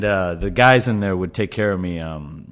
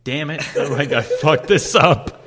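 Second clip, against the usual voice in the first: laughing at himself; 4 semitones higher, slower than usual. Retold or imitated. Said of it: "And I'm like".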